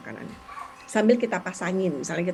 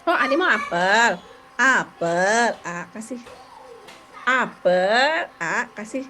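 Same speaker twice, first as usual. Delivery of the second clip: modelling a prompt; much slower than usual. Shown as a demonstration. Therapist pairing the word while handing over apple, slow prompting. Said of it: "terus kita pasangin, kita bantu".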